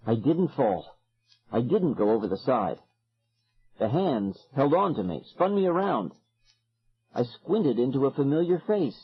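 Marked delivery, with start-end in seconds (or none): none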